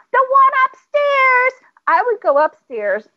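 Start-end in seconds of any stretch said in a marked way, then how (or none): 0.1-1.5 put-on high, drawn-out kid's holler; imitated small boy's singsong yell; child yelling. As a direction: call it slowly from another floor. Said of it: "He would yell".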